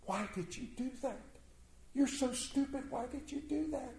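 high, soft, half-sobbing scold; a hint of crying as she chides him; tearful reproach. Queen weeping over her wounded husband: soft tearful scold. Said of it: "falls on the bed crying".

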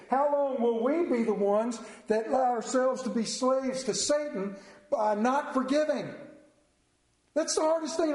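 preacher urging the flock in rising appeal; impassioned preaching. Acted. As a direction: plead with the congregation. Preacher pleads with congregation.

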